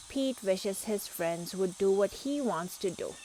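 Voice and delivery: plain reading voice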